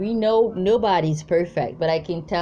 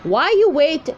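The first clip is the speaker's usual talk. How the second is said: retold confrontation; 6 semitones higher, 3 dB louder than usual. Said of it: "I'm like".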